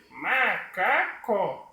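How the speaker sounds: high puppet voice saying "macaco" as ma... ca... co; slow, high puppet, three separate syllables. Shown as a demonstration. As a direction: stretch each syllable in a squeaky puppet voice. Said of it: "ele vai abrir a boca três vezes".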